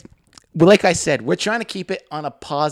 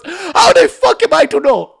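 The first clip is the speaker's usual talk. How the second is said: very high, loud, drawn-out mock outrage; hammed-up accented coworker blowing up; Hassan going off; 9 dB louder, slower than usual. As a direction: wail it in exasperated imitation. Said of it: "he just goes off".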